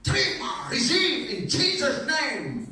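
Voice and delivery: high, loud, slow English prayer shout; foreign preacher. Retold or imitated. Foreign minister praying over the believer, loud and slow. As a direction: proclaim it slowly like a foreign preacher.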